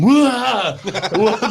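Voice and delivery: high laughing yelps of fright; startled yelp. Retold or imitated. Retelling waking to friend standing over him, laughing startled yelps. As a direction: yelp like you just woke to a threat.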